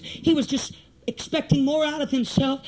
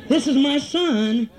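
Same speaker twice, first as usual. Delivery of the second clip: low, slow imitation of a mother; imitated mother; 4 semitones lower, 5 dB louder, slower than usual. Mother bringing little Johnny to church, imitated mother. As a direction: play the mother introducing her child, drawn out and low. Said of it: "and you're going".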